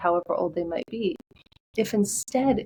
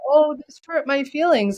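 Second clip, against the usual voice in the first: put-on hurt inner child; 6 semitones higher, 4 dB louder than usual. Inner child voiced in a story.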